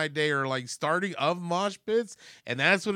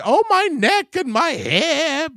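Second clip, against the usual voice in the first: high, loud, drawn-out fake wail; mock wailing old lady; 12 semitones higher, 8 dB louder, slower than usual. Old lady faking injury on the ground, mock wail. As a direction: wail slowly as if badly hurt, hammed-up. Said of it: "crawl on the ground and then say".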